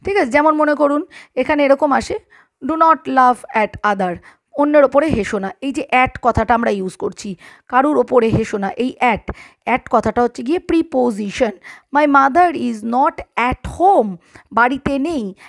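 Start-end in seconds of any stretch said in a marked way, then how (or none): 2.6-4.2 deliberate word-by-word example; slow example for pupils; slow dictation. Shown as a demonstration. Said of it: "dictating example sentence". 11.9-14.2 deliberate word-by-word example; slow example for pupils; slow dictation. Shown as a demonstration. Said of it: "dictating example sentence".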